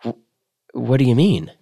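faltering, stammered start with mild fear; a hint of fright creeping into the question; a touch of fear. A narrator's reading. Student startled by the professor's offer, mildly afraid. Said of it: "heard something like fear in his voice".

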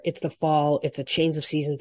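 ordinary talk

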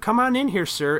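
high-pitched mock TSA agent; impatient agent. Voicing agent ordering frail man.

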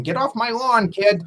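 mock porch yell, pitched high; high-pitched hammed-up old grouch; crotchety old man. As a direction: gripe like a crotchety old guy shooing kids. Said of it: "old crotchety guy on the porch yelling".

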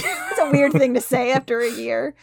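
ordinary talk, nothing marked

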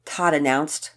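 plain reading voice

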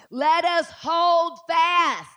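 slow, loud, high scripture read; preaching shout. Real, not acted. Preacher proclaiming the verse to the church, slow and loud. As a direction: hammer out the verse slowly.

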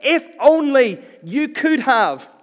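loud, high, slow pained cry; anguished lament. Acted. Martha beating Jesus' breast, loud slow anguished cry. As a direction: drag it out in grief. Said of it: "beat upon his breast".

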